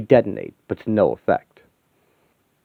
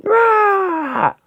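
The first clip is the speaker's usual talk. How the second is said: high battle yell. Acted. A hero yells while smashing a robot. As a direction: yell through the strike.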